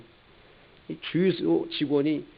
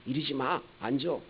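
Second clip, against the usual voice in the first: calming wife; 8 dB quieter than usual. Retold or imitated. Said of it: "부인 되신 분이".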